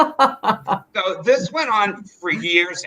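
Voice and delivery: slow, laughing through the words. Real, not acted. Teller cracking up at her childhood drama, laughing. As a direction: let the laugh spill through.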